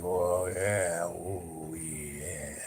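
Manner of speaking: blissed-out stoner. Retold or imitated. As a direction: sigh it out, dazed and content. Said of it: "come out like".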